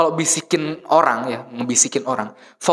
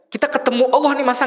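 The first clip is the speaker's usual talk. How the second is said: high, pressing appeal; preacher's impassioned rise; urgent; 10 semitones higher than usual. Acted. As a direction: lift the voice in urgent wonder. Urging feeling in prayer.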